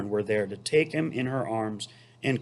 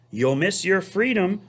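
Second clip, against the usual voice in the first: higher goose warning; high scolding goose; honking goose; 7 semitones higher than usual. Acted. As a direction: honk the warning at him. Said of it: "honked the goose".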